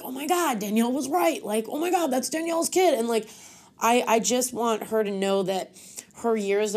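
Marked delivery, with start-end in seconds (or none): 0.0-3.0 imitating amazed people, pitched higher; impressed onlookers. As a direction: play admiring strangers gushing. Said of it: "for them to be like".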